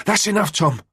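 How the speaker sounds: high, loud forceful roar. Acted. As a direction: bellow it to stop him. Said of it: "she roared at him with surprising force".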